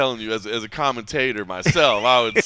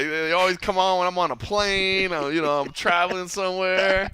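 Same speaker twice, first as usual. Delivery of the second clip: imitated complaint; 7 semitones higher than usual. Imitating Mark Fellows complaining.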